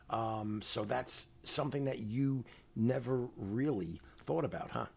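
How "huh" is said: usual speaking voice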